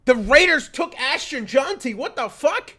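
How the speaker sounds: high, loud, disbelieving yell; shocked outburst. Real, not acted. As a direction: shout in sudden disbelief. Shocked by a draft pick.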